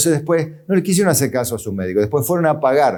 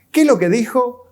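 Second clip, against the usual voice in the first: mocking question; 9 semitones higher than usual. Mocking empty preachers.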